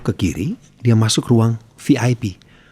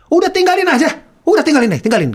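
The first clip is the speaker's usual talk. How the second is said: much higher, louder, strained shout ordering him out; imitating a shout; 4 dB louder, faster than usual. Angry official yelling at the MC to leave, shouted. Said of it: "Dia teriak lagi".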